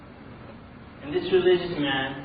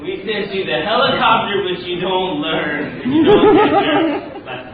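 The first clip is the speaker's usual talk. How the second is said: louder and higher, breaking into laughter; exasperated punchline dissolving into a laugh; laughing mock scolding; 6 semitones higher, 11 dB louder, slower than usual. Angel scolding stubborn man, mock exasperation with laughter.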